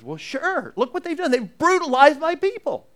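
high-pitched indignant protest; acted indignation. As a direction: protest as if it were obvious. Voices indignant answer to Jonah's question.

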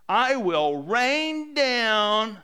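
loud, high, drawn-out proclamation; booming divine threat. Acted. Preacher voicing God answering the grumblers, booming. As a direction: stretch each word out with mounting force.

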